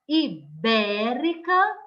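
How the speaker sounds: ibérica split after i, BÉ stretched; stress on antepenultimate syllable. Shown as a demonstration. Teacher shows antepenultimate stress to pupils, bé stressed in ibérica. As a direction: lean hard on bé in ibérica. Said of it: "a sílaba tônica é a antepenúltima".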